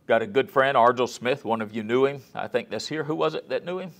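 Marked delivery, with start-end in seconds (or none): none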